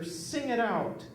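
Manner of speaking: drawn-out mock command; imitating the fussy lady; bossy customer. Customer demands he call the pressure.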